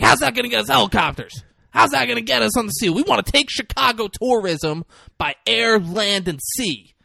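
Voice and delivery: high, loud, fast mock protest; exasperated arguing. Acted brothers' argument over business.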